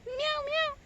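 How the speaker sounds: high meowing call to a cat. Pretend. Woman calling a stray cat, high mock meow.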